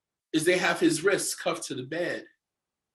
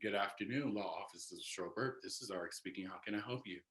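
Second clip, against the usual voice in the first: lower, much softer polite receptionist voice; office phone greeting; 9 semitones lower, 15 dB quieter than usual. Retold or imitated. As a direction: answer like a law firm receptionist. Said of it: "like I always do".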